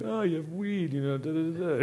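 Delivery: lightly voiced Dutch cop catching him out; playful impression of a cop; imitating the cop. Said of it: "the guy's gonna be like".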